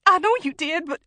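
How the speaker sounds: high, loud, on the edge of sobbing; desperate, broken-off reply; tearful protest. A narrator's reading. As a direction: placate him through rising panic. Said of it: "A sob rolled out of her mouth".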